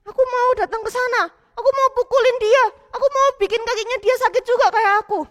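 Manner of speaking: put-on high, insistent, angry child voice; vengeful boy. Matthew demanding revenge on Tori, angry. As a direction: beg your dad to go punish the bully.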